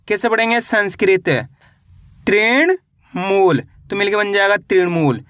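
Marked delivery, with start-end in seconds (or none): 2.3-3.6 slow, syllable by syllable, तृण then मूल with a gap; split into parts. Shown as a demonstration. Teacher spelling the word out slowly for children. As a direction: break तृण मूल into two slow halves. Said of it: "पहले तृण पढ़ो, उसके बाद मूल पढ़ो".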